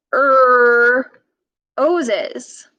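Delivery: er, oses, drawn apart; er then oses. Shown as a demonstration. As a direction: split Er from oses. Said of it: "Roses, that's right".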